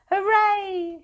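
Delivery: high, loud cheer; crowd cheer. A narrator's reading. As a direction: cheer like a whole village. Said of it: "shouted the people".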